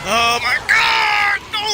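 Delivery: high, loud, slow wail; despairing shout. Real, not acted. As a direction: cry out at the loss. Game failure.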